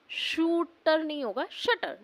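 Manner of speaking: ordinary delivery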